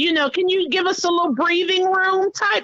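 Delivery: high, placating mock plea; backpedaling boys. Boys caught by mother asking for breathing room, high placating. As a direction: imitate kids pleading their way out of trouble. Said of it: "then they try to cop deuces".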